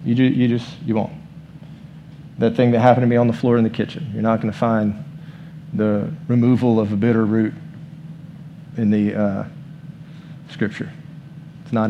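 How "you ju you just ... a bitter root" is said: normal talking voice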